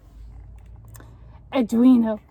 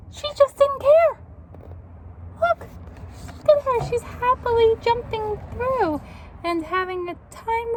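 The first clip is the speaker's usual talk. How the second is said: much higher, bright gleeful storytelling; delighted; 7 semitones higher than usual. Real, not acted. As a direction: squeal with joy at her happiness. Delighted picture description.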